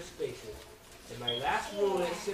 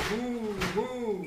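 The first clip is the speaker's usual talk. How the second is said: long drawn-out moo, pitched up; cow mooing; 4 semitones higher than usual. Pretend. Reader imitates Mister Brown's cow, long mooing. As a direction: moo like a cow for young listeners. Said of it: "He can sound like a cow".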